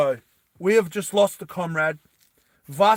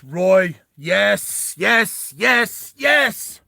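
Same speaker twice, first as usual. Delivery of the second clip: real elation, climbing higher and louder; excited cheering; 5 semitones higher, 8 dB louder, much slower than usual. Real, not acted. Player cheers on Roy's move, mounting excitement. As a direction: celebrate with each word punched higher.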